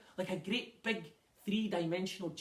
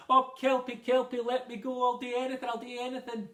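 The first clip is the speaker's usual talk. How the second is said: loud, high desperate begging; frantic plea, pitched up and loud; pleading; 5 semitones higher, 6 dB louder than usual. A narrator's reading. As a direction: beg for your life on your knees. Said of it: "He pleaded".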